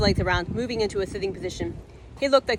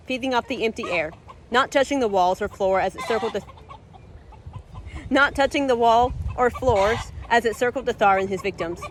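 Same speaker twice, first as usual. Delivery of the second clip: ordinary delivery, nothing marked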